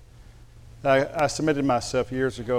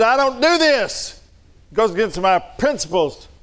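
mock fuming; 8 semitones higher, 4 dB louder than usual. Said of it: "I fumed around the house".

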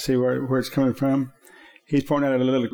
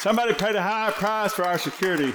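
preaching at full force; 8 semitones higher than usual. Real, not acted. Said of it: "preached climax line".

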